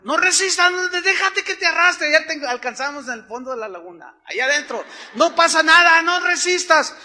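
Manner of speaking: high, loud shouted instructions; shouting. Retold or imitated. Friends shout to the man swept away by the current. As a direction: yell it across the water so he hears. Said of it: "ellos me gritaron".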